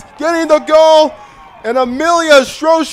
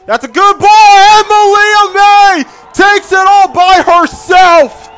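higher, louder goal call; excited goal call; 13 dB louder, much slower than usual. Real, not acted. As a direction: ride the rising goal call. Goal scored.

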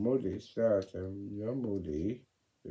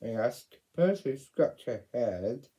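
ordinary delivery

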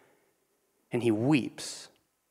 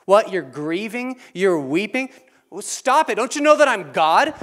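higher, dismissive mock voice; mock uncaring God; 6 semitones higher, 4 dB louder than usual. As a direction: scoff at the grief like a cold god. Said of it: "we do not serve a God that's like".

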